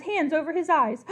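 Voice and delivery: ordinary delivery, nothing marked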